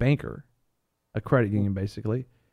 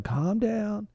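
high, sing-song calm down; mock soothing banker; 11 semitones higher than usual. Bank telling frightened customers to settle, mock soothing. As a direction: coo it at the panicked public.

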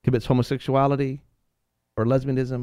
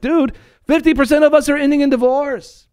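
pitched way up and louder, like pleading with the crowd; exasperated high outcry; 10 semitones higher, 4 dB louder than usual. Retold or imitated. Preacher telling his church, in an exasperated high cry. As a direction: throw it out high and loud, dude-style. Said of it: "And it's like".

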